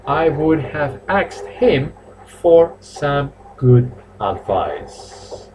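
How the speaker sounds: teacher repeats the model sentence for writing; very slow repeat. Shown as a demonstration. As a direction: drag each word out.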